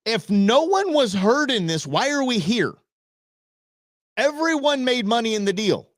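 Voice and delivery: mildly incredulous judge; lightly voiced liberal justice; quoting the justice.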